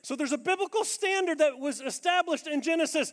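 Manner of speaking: high, loud, emphatic preaching; preaching at full force. Real, not acted. Preacher laying out the biblical standard, loud. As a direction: drive each point home.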